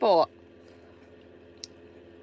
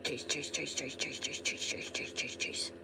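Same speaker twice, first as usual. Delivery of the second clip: whispered calling; 8 semitones lower, 17 dB quieter than usual. Real, not acted. Whispering cheese to the dog.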